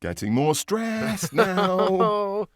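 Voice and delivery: high, loud, drawn-out mock panic; mock rising stress. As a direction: stretch it out as a hammed-up stressed wail. Mocking own recorded stress.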